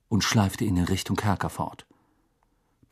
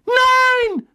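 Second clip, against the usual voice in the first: very high, loud scream; long, piercing scream of protest; shriek; 7 dB louder than usual. A narrator's reading. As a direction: cry it out in horror. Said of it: "schrie Professor McGonagall".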